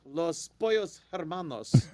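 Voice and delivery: rolled Spanish Los Pollos Hermanos, slow; Los Pollos Hermanos in a Spanish accent, slowly. Acted. Ad read, naming the apron in a Spanish accent. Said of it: "That, that accent was great".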